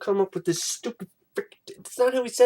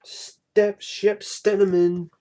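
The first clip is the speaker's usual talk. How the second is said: sign-off; 3 semitones higher, 5 dB louder, much slower than usual. Real, not acted. Outro sign-off.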